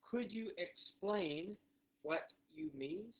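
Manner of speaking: very slow, rising on each half; drawn-out, spaced question; slow repeat model. Shown as a demonstration. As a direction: say it word by word for learners to copy. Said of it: "One more time".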